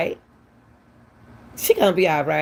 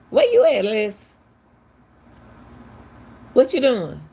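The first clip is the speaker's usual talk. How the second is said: mock girl voice; 6 semitones higher than usual. Retelling the girl's message to Les, high mock voice. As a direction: toss it up high like a curious girl calling out. Quoting the young lady's message.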